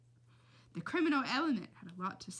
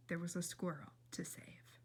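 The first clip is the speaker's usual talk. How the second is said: hushed urgent whisper; 3 semitones lower, 9 dB quieter than usual. A narrator's reading. Narrator whispering the urgent stakes to listeners. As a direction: breathe it like a secret mission.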